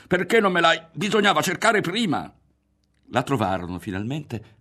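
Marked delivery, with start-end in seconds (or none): 0.1-2.3 high loud scolding; angry reproach. A narrator's reading. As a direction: blame him, frantic. Berto scolding in a hurry. (rimprovero rabbioso e alto)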